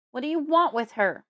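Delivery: loud furious demand; father yelling. A narrator's reading. Father shouting at Fred to protect daughter. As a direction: bellow it at the floor. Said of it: "he yells".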